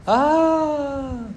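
high mock yelp of pain; child's yelp. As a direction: squeal like the spanked boy. Child spanked cries out.

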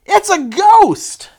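high, loud, drawn-out scream of alarm; hammed-up panicked shriek; fearful cry. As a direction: cry out like terrified sailors. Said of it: "In their fear, they cried out".

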